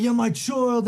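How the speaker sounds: very high Irish taunt; high put-on Irish accent; Conor McGregor. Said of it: "He's like".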